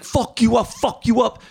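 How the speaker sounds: high mock threat, repeated; imitating threatening driver. Narrator imitates the driver threatening to beat him, high mock threat. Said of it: "He's like".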